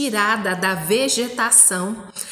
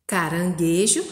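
caranguejo joined up, lower, rising at the end; lower, connected read-back with a lift at the end; whole-word reading; 4 semitones lower than usual. Shown as a demonstration. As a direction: blend the syllables into one word. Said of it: "que tá escrito aí de verdinho".

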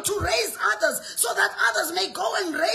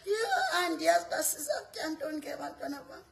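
mock weak mother; 2 semitones lower, 7 dB quieter than usual. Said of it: "not a mother that says".

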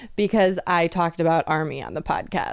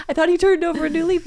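much higher, fast, laughing at her own naivety; laughing repeat; 11 semitones higher, 4 dB louder, faster than usual. Retold or imitated. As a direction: say it again cracking up. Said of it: "I was like".

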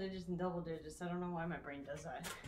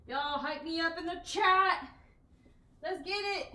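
loud, high, pumped call to chat; rally the chat, fired up; hyped up; 8 semitones higher, 8 dB louder than usual. Real, not acted. Streamer calling chat to hype her before set, excited. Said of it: "hyping herself for the set".

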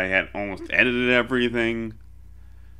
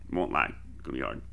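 quiet fast aside; 8 semitones lower, 7 dB quieter, faster than usual. Real, not acted. Repeats that it will be hard.

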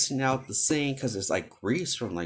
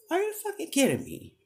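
much higher, fast disbelieving outburst; incredulous outrage; faster than usual. Retold or imitated. Telling the coworker off over the fresh dishwater, shrill disbelief. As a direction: shriek it as if you can't believe her nerve.

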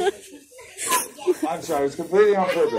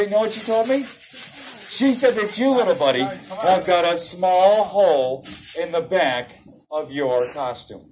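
usual speaking voice

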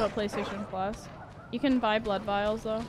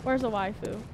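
usual speaking voice

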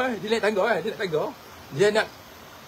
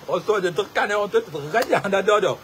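normal talking voice

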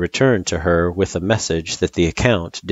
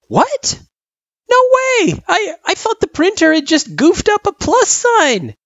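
put-on very high, louder child voice in disbelief; shocked kid; 6 dB louder than usual. Frisk learning the symbol means division, shocked. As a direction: squeal it in astonished protest.

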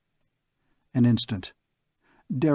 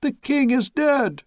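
much higher, louder, slow shout; crowd shouting; 13 semitones higher, 3 dB louder than usual. Acted. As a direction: cry it out over the din. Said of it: "voices shouting".

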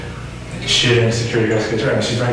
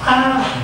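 imitated scream; 14 semitones higher than usual. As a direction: imitate her scream without full volume.

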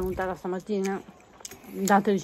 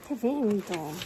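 ordinary delivery

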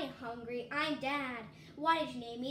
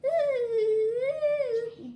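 high, loud put-on wail; hammed-up shriek in an odd voice; loud mock wail; 7 semitones higher, 8 dB louder than usual. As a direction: belt out a silly shocked "ooh". Shouted high strange voice.

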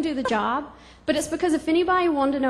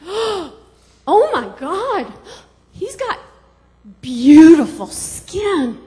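gushing, slowed awe, louder at the end; mock awe; 2 semitones higher, 10 dB louder, much slower than usual. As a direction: swoon over his skin in mock amazement. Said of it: "and I was like".

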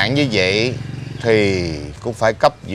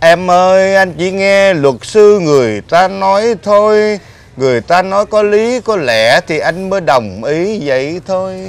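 high, loud plea to his wife; loud pleading; 6 semitones higher, 5 dB louder than usual. Acted. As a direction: beg her to listen, voice raised. Husband pleads with angry wife.

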